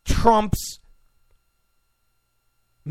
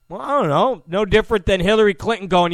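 normal talking voice

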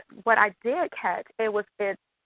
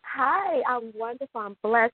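ordinary delivery

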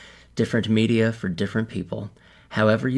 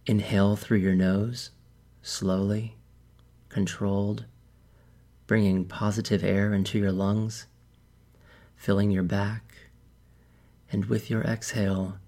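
calm meditation guide, unhurried with long pauses; measured, soothing guidance that leaves space to breathe; guided meditation. Acted. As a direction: lead them gently into relaxation, phrase by phrase.

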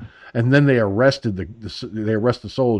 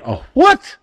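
mock-shocked, high loud "what"; re-enacted outraged double take; shocked reaction; 6 dB louder than usual. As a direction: jump up high and loud, taken aback. Taken aback by the word.

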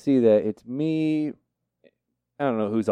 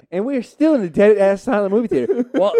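ordinary talk

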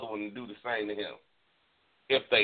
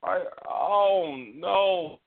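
horrified disbelief; 8 semitones higher than usual. Retold or imitated. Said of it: "You know, like".

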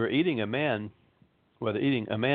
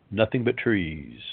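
usual speaking voice

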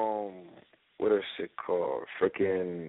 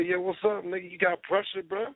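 higher, cocky challenge; retold challenge; 9 semitones higher than usual. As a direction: throw it back at him, daring.

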